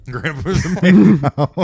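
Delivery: high, loud, cracking up on the words; laughing repeat. Retold or imitated. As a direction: echo it slowly through big laughter. Repeats the odd line, cracking up.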